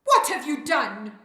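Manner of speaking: loud, accusing yell; shout. A narrator's reading. Naledi confronts the fairy over vanished sounds, shouting. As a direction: shout it at them in outrage. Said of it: "Naledi looks up and shouts".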